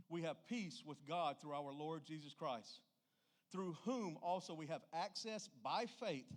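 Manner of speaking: usual speaking voice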